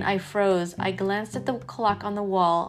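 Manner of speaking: ordinary delivery, nothing marked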